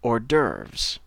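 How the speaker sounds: "Hors d'oeuvres" as American "or-derves"; American pronunciation. Shown as a demonstration. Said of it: "la prononciation du mot, c'est".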